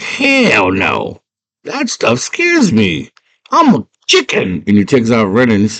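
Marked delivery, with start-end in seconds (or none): 0.0-4.6 high, slow cowardly refusal; scared Hoggle. Retold or imitated. Hoggle refuses to help Sarah, high scared squeak. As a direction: squeak out a frightened no.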